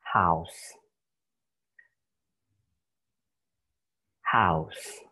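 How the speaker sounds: "house" said clearly as /haʊs/ with a falling end; teacher demo of "house"; model pronunciation. Shown as a demonstration. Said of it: "you should read it".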